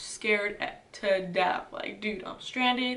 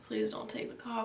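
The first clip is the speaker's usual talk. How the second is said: whispered plea; 9 dB quieter than usual. Retold or imitated. Panicked plea before the car.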